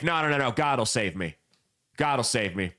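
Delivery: low, stubborn God will save me; stubborn refusal. Retold or imitated. Flooded man refusing his neighbor's ride, low and stubborn. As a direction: refuse flatly, sinking low.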